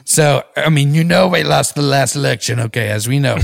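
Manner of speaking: Donald Trump. Acted. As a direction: brag with self-important emphasis. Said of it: "Give him some Donald".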